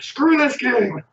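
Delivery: high, drawn-out mock frustrated cry; exasperated retold outburst. Player recalling a game he hated, exasperated outburst. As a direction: stretch it out high, acting fed up. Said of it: "I'm like".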